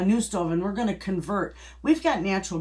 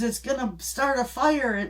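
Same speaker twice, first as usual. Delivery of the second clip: panicked cry; 8 semitones higher than usual. Retold or imitated. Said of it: "she started screaming".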